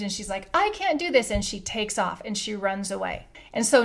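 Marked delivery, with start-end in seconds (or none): 0.5-1.3 high-pitched imitation of freaking out; mock panicked scream. Woman freaking out at the front of the line, mock panicked cry. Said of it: "she freaks out and she screams".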